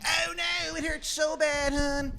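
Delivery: very high, strained falsetto wail; Shithead Steve's dying voice. Acted. GM voicing Steve crying out as he dies, falsetto wail. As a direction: squeal it out like a dying man. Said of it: "And he's going like".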